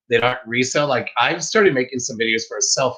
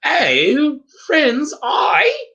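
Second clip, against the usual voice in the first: hammed-up British greeting, pitched way up; squeaky, exaggerated mock-British hello; bad British accent; 3 dB louder, much slower than usual. Shown as a demonstration. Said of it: "read Flipping Sloth's comments with a British accent".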